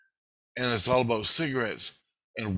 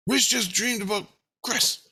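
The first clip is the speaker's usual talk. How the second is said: high-pitched, eager announcement; imitated innocence; 11 semitones higher than usual. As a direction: voice an innocent inner self sharing news. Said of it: "My innocence says to Jesus".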